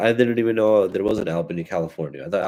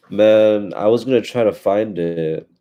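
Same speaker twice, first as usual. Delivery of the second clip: ordinary delivery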